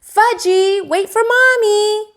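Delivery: put-on high loud call after a running toddler; hollering. A narrator's reading. Mother chasing Fudge in the park, hollering high and loud. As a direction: holler after him, sweet but urgent.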